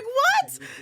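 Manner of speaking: very high, incredulous squeak; retold shock. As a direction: play up your shocked reaction. Retells own shocked reaction, very high.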